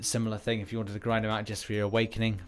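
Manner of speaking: normal talking voice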